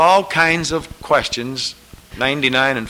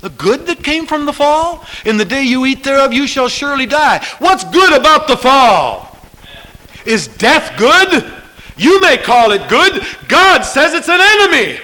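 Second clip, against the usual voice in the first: high, loud sermon voice; preaching at full force; 12 semitones higher, 6 dB louder than usual. Acted. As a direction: hammer the point home. Preaching after the parody.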